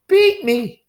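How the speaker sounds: shrieked high and very loud; a woman screaming at her husband; furious cry. Acted. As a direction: scream it at him in rage. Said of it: "he heard her cry".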